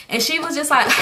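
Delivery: normal talking voice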